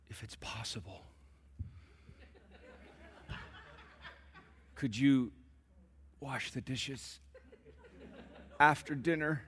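whispered, overly polite request; mock timid whisper. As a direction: hush it like you're afraid to ask. Mom asking politely in whisper.

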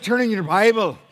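loud Scottish preacher reading out the text; a Scottish pastor giving the text; Scottish accent. Retold or imitated. Scottish pastor telling his church to open their Bibles. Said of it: "when you're from Scotland".

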